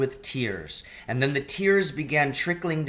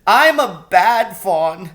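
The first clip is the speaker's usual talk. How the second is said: sobbing; 8 semitones higher, 7 dB louder than usual. A narrator's reading. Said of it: "between his sobs".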